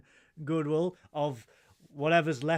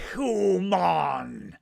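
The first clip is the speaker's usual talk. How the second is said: Ferengi voice. Shown as a demonstration. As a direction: bark it through a gum shield. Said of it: "just say the word".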